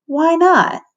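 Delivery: put-on high little-girl question; chirpy toddler. Toddler asks why, in high chirpy voice. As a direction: ask like a curious tot. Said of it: "toddler voice".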